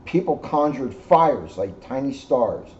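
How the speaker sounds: ordinary delivery, nothing marked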